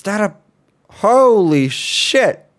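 high, slow and loud, stretching out holy; drawn-out amazed gasp of words; shocked. Real, not acted. Player spotting something scary in the game, high slow astonishment.

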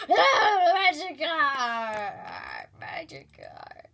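wailing name, then sobbing; drawn-out grieving sobs; crying. Acted. Mourning the eaten Magikarp.

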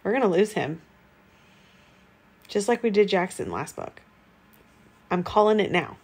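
normal talking voice

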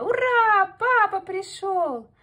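put-on very high, loud, slow kids' cheer; excited cheer. Hare kids cheer as their father comes home, high and loud. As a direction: cheer with joy as papa arrives.

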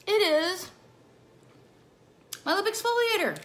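high, loud, bright-eyed reveal of the secret; excited reveal. Acted. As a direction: announce it like a big surprise. Big reveal of favorite product.

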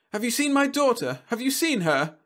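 high, distressed woman's call; a mother searching in panic; frantic mother. Acted. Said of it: "a woman was crying somewhere".

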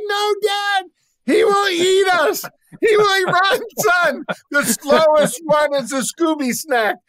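put-on high, loud frightened boy shouting; young son shouting at dad while fleeing bear, high scared yell; scared kid. Squealing terrified child voice. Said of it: "And he goes".

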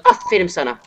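loud, bitter "Aferin sana" shouted; real angry mock praise; furious sarcasm. Real, not acted. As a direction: fling the "Aferin" as an insult. Sarcastic praise while raging.

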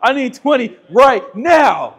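slow, drawn-out drill sergeant yell; coach shout. Acted. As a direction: bark the order like a coach. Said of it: "crank them push-ups out".

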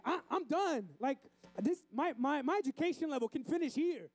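high-pitched mock refusal; protesting like the kid. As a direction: quit like a fed-up first grader. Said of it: "I feel like we're like that".

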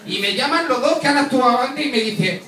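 ordinary delivery

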